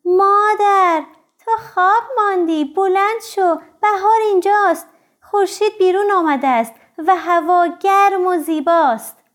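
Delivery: loud, high cub calling to mother; excited little bear's cry. A narrator's reading. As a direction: cry out the news, pitched high. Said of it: "خرس کوچولو فریاد زد". (فریاد بلند و زیر خرس کوچولو)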